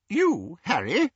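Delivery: high, eager little wizard voice; a chirpy, over-polite wizard explaining the plan; Daedalus's high, excitable voice; fussy wizard Daedalus. A narrator's reading.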